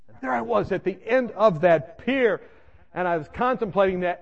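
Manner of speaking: usual speaking voice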